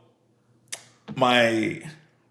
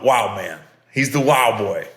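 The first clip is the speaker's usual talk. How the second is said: ordinary delivery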